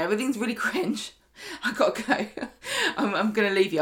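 laughing through the words; trying to read on through real giggles; giggling. Real, not acted. Reader acting Lauren's exit line, giggling. Giggles while reading out quote.

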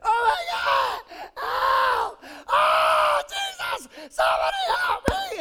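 put-on very high, loud, slow shrieking child's panic; screaming little sister. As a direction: shriek for help like a trapped girl. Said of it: "she'd be out there".

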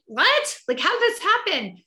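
shocked disbelief. Retold or imitated. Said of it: "And I'm like".

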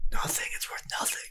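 hushed, whispered exchange; under-the-breath whisper; whispered aside. Acted. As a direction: whisper it secretly to your ally. Secret aside between allies.

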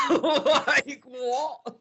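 shrieked disbelief, high and loud; high squeal of disbelief; incredulous. Real, not acted. Outraged at billing.

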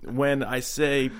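lightly voiced presidential excuse-making; a hint of folksy politician, pausing mid-alibi; Bill Clinton. Shown as a demonstration. Explaining away the flight log, lightly voiced Bill Clinton. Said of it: "Can you do a Bill Clinton".

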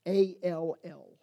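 spelling all letter by letter; A L L spoken as three separate letters; spelled out. Shown as a demonstration. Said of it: "the idea of all".

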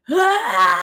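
loud, high birthing scream, imitated; hammed-up scream of a woman in labor; mock primal scream. Podcaster imitating Kirk's screaming on the bed, mock primal scream. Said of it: "primally screaming while stre".

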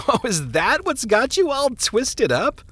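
high, amused, scoffing through a chuckle; laughing. Acted. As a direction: laugh off his worry. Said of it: "the minister laughed".

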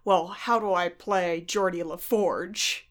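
ordinary talk

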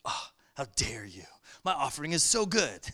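low, sulky mock outrage; indignant Cain. Preacher voicing Cain's offended pride, low and indignant. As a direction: play a huffy Cain whose gift was refused.

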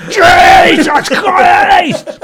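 loud, slow, laughing exclamation; shocked shout. Retold or imitated. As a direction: bellow it in shock. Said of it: "looking and being like".

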